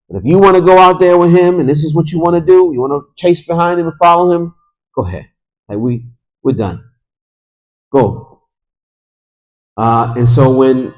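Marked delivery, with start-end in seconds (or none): 0.1-8.4 exasperated scolding. Retold or imitated. Family scolds woman for chasing after husband, exasperated. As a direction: rattle it off like a fed-up relative.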